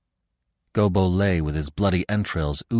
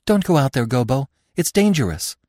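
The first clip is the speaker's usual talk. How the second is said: high, louder frightened plea; a doe pleading with Gobo to stay; 7 semitones higher, 4 dB louder than usual. A narrator's reading. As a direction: beg him not to go. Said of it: "Feline began to plead".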